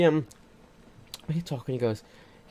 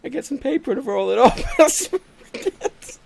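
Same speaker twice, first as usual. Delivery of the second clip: 1 laughing quote; 7 semitones higher than usual. Retold or imitated. Quoting the boy through laughs.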